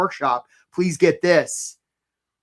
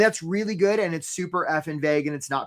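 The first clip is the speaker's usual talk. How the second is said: ordinary delivery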